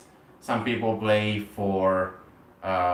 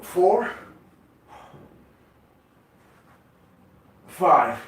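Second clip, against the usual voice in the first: higher, louder strained count; straining on reps; 7 semitones higher, 4 dB louder than usual. Real, not acted. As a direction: push the count out through effort. Last reps of set.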